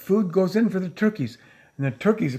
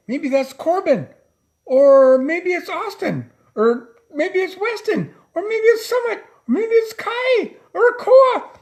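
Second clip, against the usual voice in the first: higher and higher with each name, ending loud; building excitement; 13 semitones higher, 5 dB louder than usual. Acted. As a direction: guess each child's name with mounting delight. Teasing guesses, building to climax.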